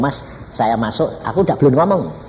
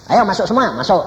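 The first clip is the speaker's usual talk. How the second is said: raised, higher call to come in; calling everyone in; 8 semitones higher, 3 dB louder than usual. Retold or imitated. Preacher calling his siblings into the room, raised voice. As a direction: summon the family into the room. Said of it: "Tuhan suruh apa?".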